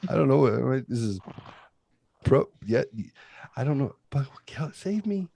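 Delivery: high, nervous pleading; panicked. Retold or imitated. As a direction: sound scared and lost. Panicked reply to dad.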